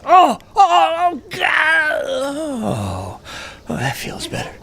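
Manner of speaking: high, hammed-up groaning that fades to a breathy sigh of relief; fake agony groans. Faking a bowel accident in the car, high mock groans. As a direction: moan like it's all coming out, then let go.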